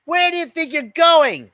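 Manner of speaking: high, loud bossy big sister; bossy challenge. A narrator's reading. Older sister challenging her sneaking sibling, loud and bossy. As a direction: stop her in her tracks.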